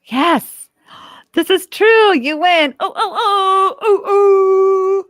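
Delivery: high, loud celebratory cheering; over-the-top victory shout; excited winner announcement. Acted. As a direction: crown the winner like a game host. Quiz host declaring winner.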